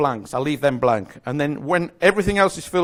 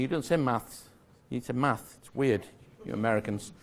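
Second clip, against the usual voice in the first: usual speaking voice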